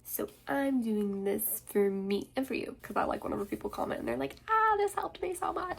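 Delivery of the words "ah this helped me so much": excited. Retold or imitated. Quoting grateful viewer comment.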